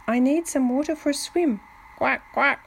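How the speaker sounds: a touch of duck voice on the reply; lightly voiced duck. A narrator's reading. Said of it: "replied the duck".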